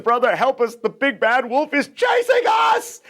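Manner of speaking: high, rising panicked cry; panicked plea. Acted. Pigs begging their brother for shelter, panicked. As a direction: beg for help, half laughing.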